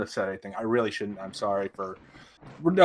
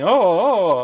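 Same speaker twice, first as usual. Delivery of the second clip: mocking whine; 7 semitones higher, 7 dB louder, slower than usual. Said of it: "he's pulling this shit and saying".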